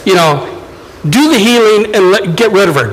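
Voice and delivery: normal talking voice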